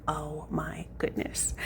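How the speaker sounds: slow, hushed, breath-heavy reaction; retold near-whisper of disbelief; hushed dismay. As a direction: draw it out under your breath in dismay. Retold reaction to finding stain.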